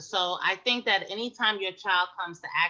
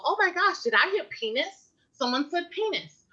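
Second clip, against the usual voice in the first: high, startled mock alarm; a bystander overhearing a child, mock shocked; shocked gasp; 10 semitones higher than usual. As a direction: act scandalized at hearing the word. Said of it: "people go".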